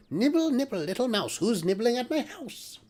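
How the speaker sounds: high squeaky witch voice, pitched well up; a tiny witch voice squeaking from somewhere; squeaky little voice. Acted. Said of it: "a squeaky little voice".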